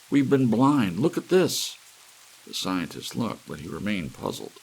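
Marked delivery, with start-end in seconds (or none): 0.1-1.8 higher and louder, breathless with discovery; excited gasp. A narrator's reading. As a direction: blurt it out as the idea hits. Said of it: "he gasped".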